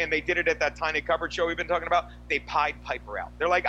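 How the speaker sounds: ordinary talk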